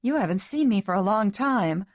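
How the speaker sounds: high, emphatic protest after a laugh; shaky amusement. A narrator's reading. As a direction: protest loudly, drawing out the words. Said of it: "She laughed shakily".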